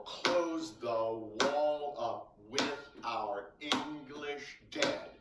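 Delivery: "close the WALL up WITH our ENGlish DEAD" very slow, pulsed; slow metrical beat through "Close the wall up", each stress landed; stressed-syllable beat. Shown as a demonstration. Teacher showing how the bouncing works, slow and metrical. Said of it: "See how that works?".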